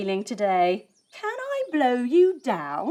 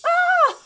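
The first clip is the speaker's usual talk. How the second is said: high loud mock scream; startled scream; 6 dB louder than usual. Bee stuck in the spider's web, mock scream. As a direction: cry out in fright.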